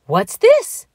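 high, loud startled question; surprised cry. A narrator's reading. Donald looks down at a tiny stranger, surprised cry. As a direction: cry out at an unexpected sight. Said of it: "he cried".

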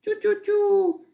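very high sing-out coaxing; high-pitched cooing bird call; calling chicks. A narrator's reading. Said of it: "zawołała na kurczątka".

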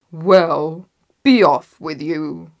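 loud, dismissive troll; roaring troll. A narrator's reading. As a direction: send them off with a loud troll voice. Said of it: "roared the troll again".

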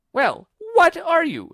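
very high, loud, drawn-out demand; slow screeched challenge; demanding pigeon. A narrator's reading. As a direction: demand an answer as an irate bird.

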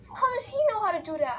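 high, loud and fast amazement; amazed. Real, not acted. Gamer stunned by the kid's knife throw, high and fast. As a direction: blurt it out in disbelief.

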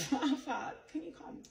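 usual speaking voice

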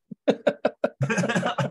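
high burst of laughter. Real, not acted. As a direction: laugh out loud in disbelief. Laughing at pragmatic resume boast.

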